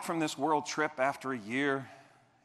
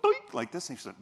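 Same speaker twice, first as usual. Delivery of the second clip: ordinary talk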